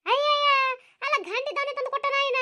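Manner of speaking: high, loud exasperated ayyayya; wailing mother-in-law, high and aggrieved. Acted. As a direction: cry out in dismay, then defend yourself. Mother-in-law exclaims defensively.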